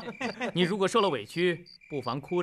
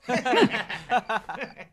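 short taunting laugh rising at the end; mocking laugh; 6 semitones higher than usual. Bully mocks the boy he beat, taunting laugh. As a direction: laugh down at the loser.

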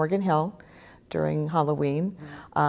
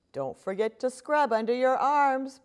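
higher, mildly pleading mom; 8 semitones higher, slower than usual. Acted. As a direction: coax the kids, a touch of begging. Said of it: "pleads the pirate mommy".

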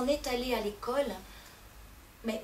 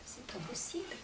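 appalled hushed reply; husband whispering his refusal; horrified whisper; 11 dB quieter than usual. Acted. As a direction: whisper it in horror.

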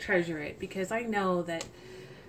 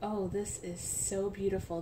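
ordinary delivery

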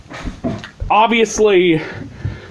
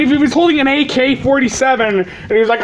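ordinary delivery

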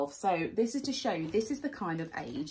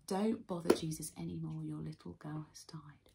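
whispered news of a death; soft hushed whisper to the father; hushed bad news; 7 semitones lower, 8 dB quieter than usual. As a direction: break the sad news under the breath. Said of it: "they're like".

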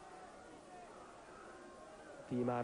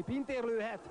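excited; 10 semitones higher than usual. Real, not acted. Commentator on a shooting chance.

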